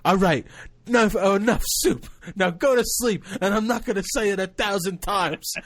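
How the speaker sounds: put-on high, loud little-girl scolding; imitating angry niece. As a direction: mimic a fed-up child playing strict mother. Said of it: "like, angry".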